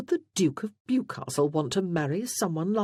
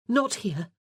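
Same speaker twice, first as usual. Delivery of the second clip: urgent; 6 semitones higher than usual. A narrator's reading. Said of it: "she said urgently".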